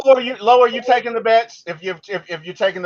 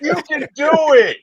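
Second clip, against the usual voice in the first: drawn-out hammy encouragement, slowing right down; mock motivational; slower than usual. Teammate cheering on a slumping player, mock motivational. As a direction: stretch it out like a pretend guru.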